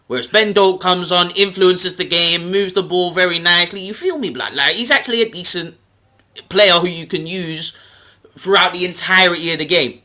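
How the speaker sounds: hammed-up London street accent. Explaining to doubting fans, in a London accent. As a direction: lay the London accent on thick. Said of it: "I'll do it in my London accent".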